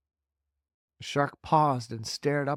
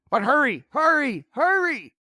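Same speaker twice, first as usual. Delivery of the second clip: loud, rising higher each time; frantic hurry; 13 semitones higher, 7 dB louder than usual. Acted. Centipede urging James, rising frantic cries. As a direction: hammer the urgency. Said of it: "said the centipede".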